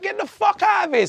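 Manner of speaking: high-pitched retold outburst; indignant dismissal. Man refusing the policeman's rules, high indignant dismissal.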